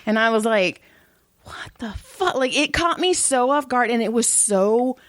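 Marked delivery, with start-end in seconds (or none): 1.4-2.4 soft, higher baffled question; retold hushed bewilderment; stunned disbelief. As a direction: say it under your breath, caught off guard. Retold stunned reaction to insult.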